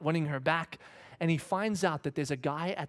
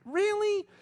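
much higher and louder question; louder shocked disbelief; 5 dB louder than usual. Retold or imitated. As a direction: ask it louder, truly shaken. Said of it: "the kind that just kinda went".

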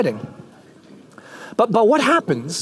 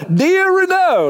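lightly voiced author writing; mock autograph; 9 semitones higher, slower than usual. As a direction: author signing the book. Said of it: "And then they sign".

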